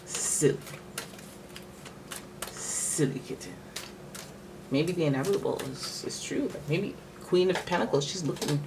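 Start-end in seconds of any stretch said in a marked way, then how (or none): 0.1-3.5 whispered fond scolding. Real, not acted. Soft aside to the cat.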